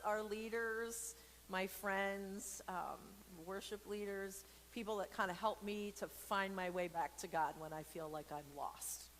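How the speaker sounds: normal talking voice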